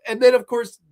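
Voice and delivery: normal talking voice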